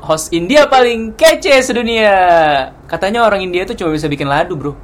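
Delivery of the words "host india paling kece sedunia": slow, showy podcast opener; host intro. Acted. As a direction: welcome them like a big-time host. (pembukaan host podcast yang lambat dan pamer)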